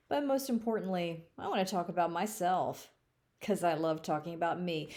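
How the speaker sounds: ordinary talk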